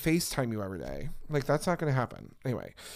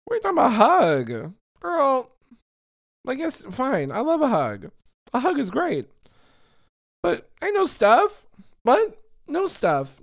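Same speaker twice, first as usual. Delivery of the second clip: much higher, louder, outraged at him; indignant high shout; 11 semitones higher, 6 dB louder than usual. Real, not acted. Telling friends about his hug offer, loud high outrage.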